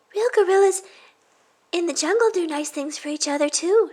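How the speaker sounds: put-on high, loud, eager kid voice; excited little girl. Tina telling friends at lunch about gorillas. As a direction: burst out with a fun fact.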